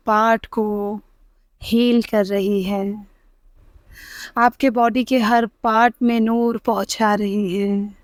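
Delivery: very soft, slow meditation voice with long pauses; hushed Islamic meditation, breath by breath; guided meditation. Acted. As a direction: guide them gently into calm, hushed and unhurried, drawing out the last words.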